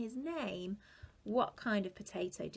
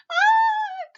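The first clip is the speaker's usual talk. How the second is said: high loud shocked shriek; a sudden high yelp of alarm; broccoli cry; 15 dB louder than usual. A narrator's reading. Broccoli finds its face drawn on, high shriek. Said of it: "cried Broccoli".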